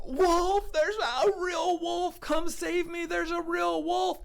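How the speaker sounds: very high, loud cry for rescue; panicked yell. Acted. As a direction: scream for help, drawing out the words. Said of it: "He freaked out".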